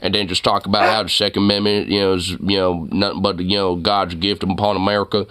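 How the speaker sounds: a hammed-up Texan good ol' boy ranting about guns; Southern cowboy accent. Streamer mocks how he'd run a side channel, in a Southern cowboy accent. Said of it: "nice principal cowboy southern voice".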